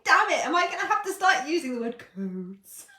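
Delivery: loud, high mock complaint; amused mock exasperation. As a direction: protest at yourself, about to burst out laughing. Mock exasperation at own vocabulary.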